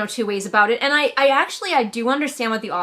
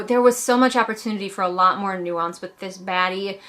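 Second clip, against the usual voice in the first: usual speaking voice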